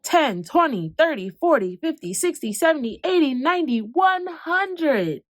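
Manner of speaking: rising eager count toward one hundred; a child blurting out the count, climbing in pitch; excited counting. Acted. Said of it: "the numbers poured out of his mouth".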